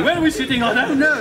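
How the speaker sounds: confused guests. Retold or imitated. Relatives coming into church, confused and calling out. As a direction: act out the muddle loudly.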